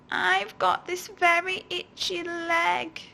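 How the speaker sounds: high, slow whiny creature. Acted. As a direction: whine like a needy creature. The thing begins whining.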